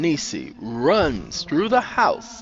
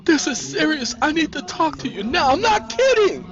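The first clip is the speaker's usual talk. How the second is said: urgent insistence. A narrator's reading. Sandra unlocking Nisi's door, urgently insistent. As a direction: press her, building to a peak. Sandra confronts Nisi inside.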